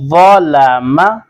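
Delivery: व लामा slowly, rounded thick "vaw", light "laa-ma"; heavy व, then thin लामा; heavy first letter. Shown as a demonstration. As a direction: stretch व लामा with a full-mouthed first syllable. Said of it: "मोटा भी पढ़ना होता है".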